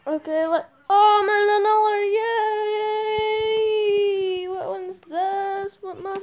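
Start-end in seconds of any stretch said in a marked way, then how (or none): 0.9-4.5 high, loud, drawn-out cheer; excited cheer. Real, not acted. Player cheering at landing in another round, excited. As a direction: whoop it with delight.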